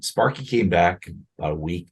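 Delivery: ordinary talk, nothing marked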